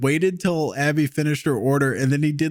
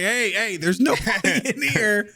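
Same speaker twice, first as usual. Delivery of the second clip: slightly drawn-out, higher imitated child; daughter complaining; 5 semitones higher, slower than usual. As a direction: lightly imitate a kid reporting a problem. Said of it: "She's like".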